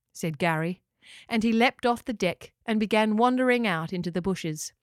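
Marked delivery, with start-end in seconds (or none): none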